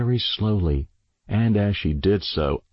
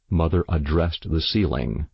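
ordinary delivery, nothing marked

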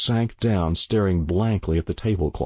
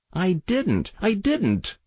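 put-on very high child's protest; tearful frantic denial; 12 semitones higher, slower than usual. A narrator's reading. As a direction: deny it frantically in a small boy's high voice. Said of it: "while tears ran down his cheeks".

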